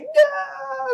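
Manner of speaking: very high, anguished scream; imitated scream. Alan in shock as Donna is eaten, high mock scream. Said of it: "he's, like, screaming".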